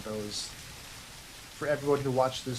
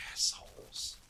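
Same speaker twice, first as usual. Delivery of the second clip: under-the-breath whisper; whispered curse; 11 dB quieter than usual. Real, not acted. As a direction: hiss it out so nobody hears. Muttering at dinos who killed him.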